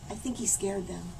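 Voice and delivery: soft confiding aside; quiet aside. A narrator's reading. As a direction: murmur it to the kids. Aside to children.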